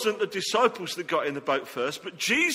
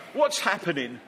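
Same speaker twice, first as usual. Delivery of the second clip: ordinary delivery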